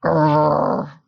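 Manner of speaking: low rumbling growl; bear growl. Acted. Bear answering grandmother with a low growl. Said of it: "growled Old Bear".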